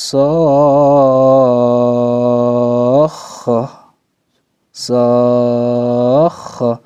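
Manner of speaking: sokhoh drawn out in recitation, the kh pressed; pressed doubled kh. Shown as a demonstration. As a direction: chant sokhoh slowly, pressing the kh. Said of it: "Ada kayak suara sokhoh". (sokhoh dibaca panjang dengan tekanan)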